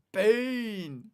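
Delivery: loud gleeful "Bean!"; excited shout. Real, not acted. Streamer cheering that the Bean film is on, loud glee. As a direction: cheer the name like a win.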